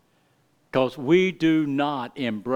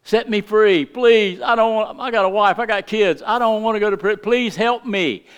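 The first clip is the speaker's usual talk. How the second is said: higher, mock-pleading debtor voice; begging debtor; 7 semitones higher, 6 dB louder than usual. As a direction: beg the master for mercy, lightly acted. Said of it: "he starts begging and pleading".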